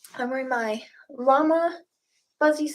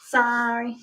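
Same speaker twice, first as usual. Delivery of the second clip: ordinary talk, nothing marked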